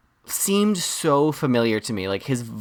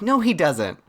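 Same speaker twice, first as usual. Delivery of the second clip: emphatic denial; 6 semitones higher, slower than usual. Retold or imitated. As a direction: shoot it down firmly. Flat denial mocking Disney.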